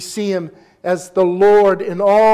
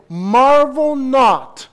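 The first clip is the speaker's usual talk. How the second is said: drawn out, higher and louder; Jesus's words, slowed for weight; slow quoting Jesus; 5 semitones higher, 6 dB louder, much slower than usual. Retold or imitated. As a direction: stretch each word like a solemn command. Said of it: "He said".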